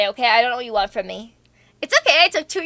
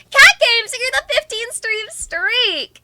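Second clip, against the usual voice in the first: high, loud streamer shout-out; excited shout-out; 9 semitones higher, 4 dB louder, slower than usual. Real, not acted. Streamer hailing a viewer's stream streak, excited. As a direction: sing out the viewer's name, then stretch the praise.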